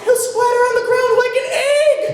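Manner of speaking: frantic high falsetto; hammed-up damsel wail, very high pitched; distressed maiden. Mother on the tower fearing for her baby, falsetto distress.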